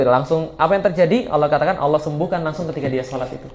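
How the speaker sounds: usual speaking voice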